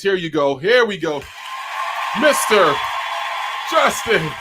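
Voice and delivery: announcer intro. Acted. Host introduces guest to audience, loud announcer hype. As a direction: build it up like a ring announcer.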